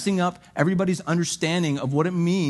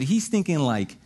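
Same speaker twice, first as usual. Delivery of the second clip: ordinary talk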